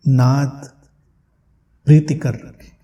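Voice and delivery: ordinary talk, nothing marked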